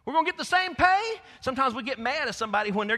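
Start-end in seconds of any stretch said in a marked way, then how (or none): 0.0-1.2 loud, high shouted complaint; workers' indignant protest. Retold or imitated. All-day workers at the back protest to the landowner, shouting. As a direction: shout the unfairness like a cheated worker. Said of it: "those at the back are saying".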